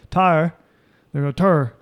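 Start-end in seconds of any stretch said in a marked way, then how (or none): 0.1-0.5 "Tire" squashed toward one syllable, Maryland style; Maryland pronunciation. Shown as a demonstration. Friend showing how Maryland says tired, "Tire" in Maryland pronunciation. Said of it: "They say tired. They go". 1.3-1.7 "Tur" as one r-heavy syllable for tired; Maryland pronunciation. Shown as a demonstration. Friend showing Maryland speech, tired said as "Tur". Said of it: "that's how they say tired".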